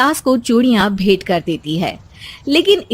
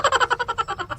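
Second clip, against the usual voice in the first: a sudden high, soft laugh; 12 semitones higher, 10 dB quieter than usual. Acted. Mother-in-law laughs at her daughter-in-law's jibe, high laugh. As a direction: laugh it off lightly.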